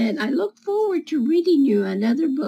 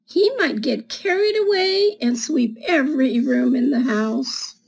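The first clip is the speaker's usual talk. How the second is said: put-on reading to children. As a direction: read it like storytime for little kids. Reads picture book to children.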